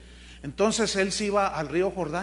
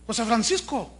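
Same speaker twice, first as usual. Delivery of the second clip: mock shocked wife; 5 semitones higher than usual. Wife catches husband with lover, hammed-up outrage. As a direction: shriek it like a telenovela wife.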